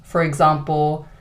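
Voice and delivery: normal talking voice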